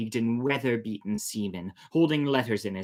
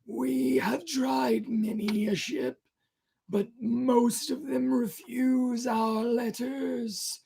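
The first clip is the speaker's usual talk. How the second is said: drawn-out wailing sailor; eerie stranger; slower than usual. Acted. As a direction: phantom seaman pleading to the crew. Ghost sailor answering.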